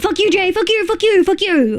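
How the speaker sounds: high, rapid-fire startled cursing; scared. Real, not acted. Streamer to Jay after a jump scare, shaken high cursing. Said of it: "you scared the fucking shit out of me".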